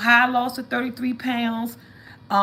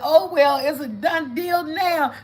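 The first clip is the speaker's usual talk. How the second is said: retold scream; 8 semitones higher, 5 dB louder, slower than usual. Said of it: "I got to screaming".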